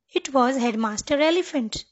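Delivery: plain reading voice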